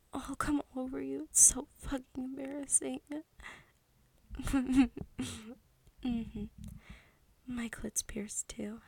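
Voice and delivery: ordinary delivery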